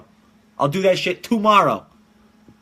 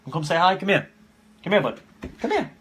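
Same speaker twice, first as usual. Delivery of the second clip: dog voice; 3 semitones higher than usual. Real, not acted. Calling his dog.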